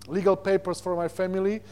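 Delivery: ordinary talk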